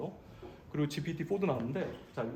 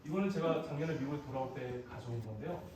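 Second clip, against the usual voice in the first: ordinary talk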